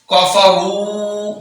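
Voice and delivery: کفروا with the final وا held two counts; long ru. Shown as a demonstration. Teacher stretches the long vowel. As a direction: draw out the رو. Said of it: "دو حرکات کے برابر لمبا".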